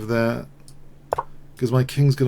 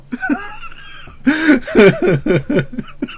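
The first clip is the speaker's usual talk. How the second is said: long squealing laugh; much higher rising giggle; high laughing fit; 5 dB louder than usual. Real, not acted. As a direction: lose it entirely. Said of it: "I'm laughing".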